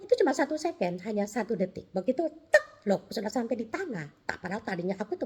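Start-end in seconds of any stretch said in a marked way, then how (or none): 2.5-2.9 loud, high "tek"; one short, sharp mouth-made tick; sudden landing tick. Retold or imitated. Showing an instant drop to earth, sudden loud tick.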